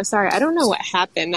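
normal talking voice

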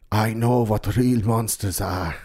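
slow, mock German accent; scary German guy. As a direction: imitate a German old man, drawing it out. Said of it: "Scary German Guy lives in the house".

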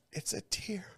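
very soft mock-tender voice; softly acted quote; hushed imitation. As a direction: imitate her in a small, hushed voice. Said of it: "She's like".

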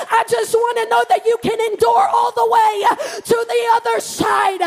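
rising, insistent sermon delivery; full-force preaching. Real, not acted. Preacher assuring church God's word holds, forceful proclamation.

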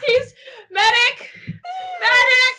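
high, loud call for a medic; man down shout. Pretend. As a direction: yell for the medic. Calling for a medic, joking.

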